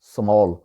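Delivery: स्मॉल with a rounded "aw", smawl; native English pronunciation. Shown as a demonstration. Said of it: "actual गोरों वाला तलफ़ुज़ है".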